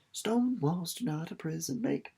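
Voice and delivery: soft, hushed line heard only inside her head; inner voice. A narrator's reading. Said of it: "her mind sang".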